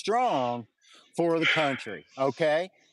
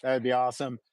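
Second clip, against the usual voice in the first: usual speaking voice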